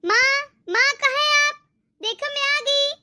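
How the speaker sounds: announcing her arrival, calling out to her mother. Acted. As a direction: call for mom as you walk in. Daughter calls out arriving home.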